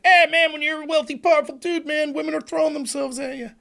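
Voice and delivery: high-pitched mock bragging dude; loose Trump paraphrase. Rich guy talking to another guy while golfing, high-pitched mock boast. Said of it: "he's basically saying".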